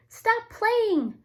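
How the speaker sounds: high, loud cry; cried out. Acted. Fletcher shouting at the rolling rabbits, high and loud. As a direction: call out to stop the rabbits. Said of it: "cried Fletcher".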